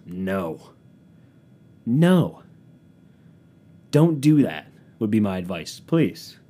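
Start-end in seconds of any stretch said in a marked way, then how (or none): none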